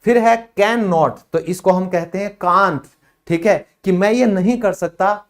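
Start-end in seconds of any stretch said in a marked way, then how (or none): none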